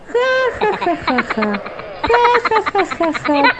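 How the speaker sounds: high burst of laughter falling away; shepherd gloating alone, laughing; gleeful laughing. Acted. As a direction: laugh at the memory of the trick. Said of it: "aún se reía cuando recordaba".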